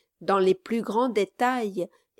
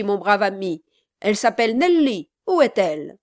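louder, urgent and insistent; forceful harangue; 6 dB louder than usual. Acted. Gentleman addressing the wedding crowd, loud and urgent. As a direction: press the crowd for the child.

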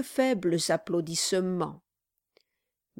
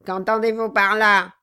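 cold question; 8 dB louder than usual. Acted. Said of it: "avec un calme parfait".

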